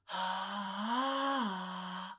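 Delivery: small breathy slide up and down; breathy little jump in pitch; breathy glissando. Shown as a demonstration. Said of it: "just a little jump like this".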